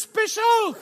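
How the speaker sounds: high, foreign-sounding barber answer; French barber voice. Retold or imitated. Lightly voiced French barber answering the customer, high. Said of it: "a French guy cutting my hair".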